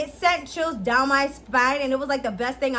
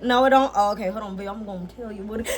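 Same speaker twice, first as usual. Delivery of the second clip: usual speaking voice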